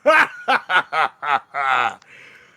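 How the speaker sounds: loud bellowing monster laughter; big, mocking minotaur guffaw; booming villain laugh. Caged minotaur laughing at his guard, booming bellow. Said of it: "he bellows his large, booming laughter".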